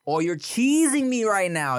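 high, drawn-out Toronto slang complaint; Toronto accent. Shown as a demonstration. Explaining slang for being mad, put-on Toronto accent.

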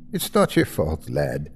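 softening Irish reassurance, falling away; fading Irish accent. A narrator's reading. Nurse forgives Parker as fright fades, Irish accent fading. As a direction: let the Irish accent ease off. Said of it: "her accent fading as the fright faded".

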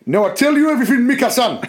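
put-on high, lilting Japanese-accented voice, a bit fast. Reading Kenzuki aloud to the class, in a high hammed-up Japanese accent. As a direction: read Kenzuki with a heavy comic Japanese accent, barely holding in laughter. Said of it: "this is now Kenzuki".

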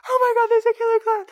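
shrieking in high mock terror; mock panicked scream. Sister mocked shrieking at the clown outside. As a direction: imitate her screaming in panic at the window. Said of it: "she is freaking out".

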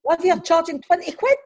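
can't believe the price; stunned outrage at the cost; disbelief. Real, not acted.

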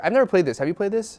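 normal talking voice

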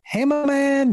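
high, chummy hello; overly nice greeting. Retold or imitated. Greeting the tutor at the door, extra nice. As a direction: pitch it up, trying hard to be nice. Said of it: "I was trying to be as nice".

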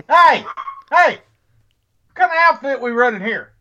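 sharp loud high yell; yelling at the dogs. Real, not acted. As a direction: bark out a stern order. Let me shut these damn dogs up.

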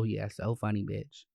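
normal talking voice

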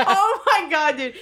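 high, loud laughing exclamation. Real, not acted. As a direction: laugh it out, squeaky. Laughing at the joke.

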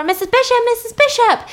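high, loud call to a neighbour; acted girl's excited shout; urgent calling out. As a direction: call through a closed door. Girl knocking, calling neighbour.

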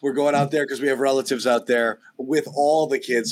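ordinary talk

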